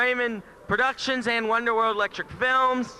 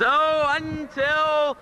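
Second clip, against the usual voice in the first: drawn-out sign-off; 5 semitones higher, 4 dB louder, much slower than usual. Acted. Host signs off show.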